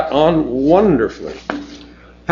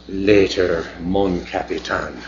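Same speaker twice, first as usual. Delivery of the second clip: Mavis; 6 semitones lower, 5 dB quieter than usual. Acted. Mavis flirting on leaving.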